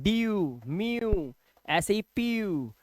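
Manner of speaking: डीयू, म्यू, पीयू each with a drawn-out "yoo"; yoo sound. Shown as a demonstration. Teacher reading example words डीयू, म्यू, पीयू, stretched yoo sound. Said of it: "यू की आवाज आपने देखी".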